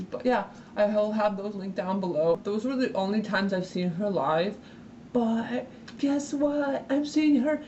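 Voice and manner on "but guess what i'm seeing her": high, drawn-out announcement; slow excited build-up to big news; really excited. Real, not acted. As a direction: stretch it out in a high, gleeful squeal. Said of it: "I'm, like, really excited".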